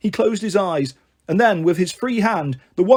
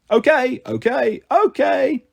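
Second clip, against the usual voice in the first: three higher-pitched sheep replies in turn; high little sheep voices agreeing; sheep chorus; 6 semitones higher, slower than usual. A narrator's reading. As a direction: answer like obedient sheep, each one higher. Sheep answer one after another.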